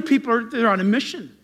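normal talking voice

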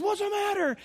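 high-pitched imitation of a concerned dad; startled dad. Dad asking his screaming kid, mock alarm. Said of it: "His dad goes".

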